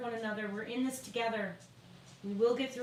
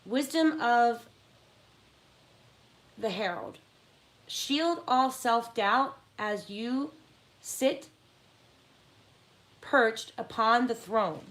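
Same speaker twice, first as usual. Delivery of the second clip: slow, louder oracle reading voice; dramatic card reading; 6 dB louder, slower than usual. Acted. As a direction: read the card like a prophecy.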